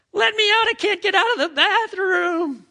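put-on very high, loud toddler wail; desperate cries. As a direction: holler like a child locked in. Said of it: "these great cries of desperation".

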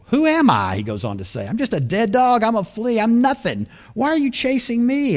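high, small, self-lowering plea; humble David. Acted. David pleading with Saul, high and self-abasing. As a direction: make yourself tiny before the king.

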